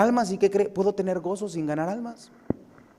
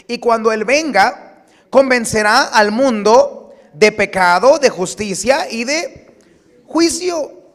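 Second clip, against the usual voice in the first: lectura enfática; 5 semitones higher, 9 dB louder, slower than usual. Acted. Lectura bíblica en voz alta.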